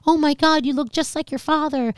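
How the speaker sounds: high gushing exclamation; imitated amazed relatives. Relatives exclaim over child's likeness, amazed gushing.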